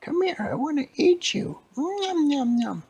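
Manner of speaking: very high, loud cartoon shriek; greedy old lady. Old lady hunting the cookie to eat, greedy screech. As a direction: screech it hungrily, gobbling the last words. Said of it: "they heard the lady".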